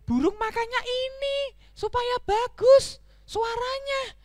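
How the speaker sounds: very high, drawn-out whine; slow, squeaky reluctant pondering; reluctant donkey. Acted. Donkey reasons despite disgust.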